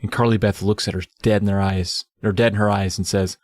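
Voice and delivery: ordinary talk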